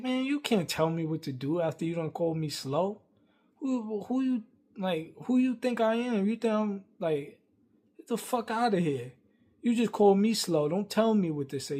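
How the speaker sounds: high-pitched, indignant retort; heated comeback. Retold or imitated. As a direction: re-enact snapping back at someone who insulted you. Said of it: "I'm, I'm tight".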